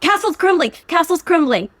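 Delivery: high, loud excited answer; excited shout. Real, not acted. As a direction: shout it out like a winner.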